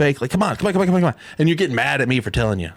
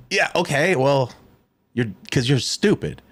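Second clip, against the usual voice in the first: ordinary talk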